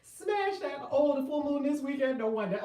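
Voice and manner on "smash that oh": normal talking voice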